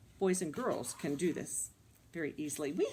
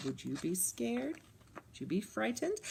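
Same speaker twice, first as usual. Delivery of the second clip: usual speaking voice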